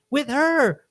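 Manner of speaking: wife insisting to her lying husband; insistent wife. Retold or imitated. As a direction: jab it up high like the fed-up wife. Acted quarrel, wife voice.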